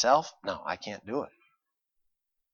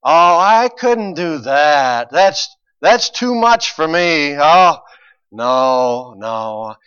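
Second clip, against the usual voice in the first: slow, drawn-out fake-humble no; mock pious refusal; 4 semitones higher, 11 dB louder, much slower than usual. Mocking the falsely humble who refuse to serve, pious drawl. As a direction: put on false modesty, slow and louder. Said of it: "a pious attitude of".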